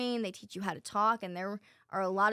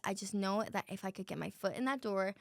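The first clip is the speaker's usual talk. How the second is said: ordinary talk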